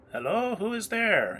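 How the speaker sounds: put-on high, loud call of an old woman. Game master voicing an old woman calling out to strangers. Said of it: "what sounds like an elderly woman's voice, call out".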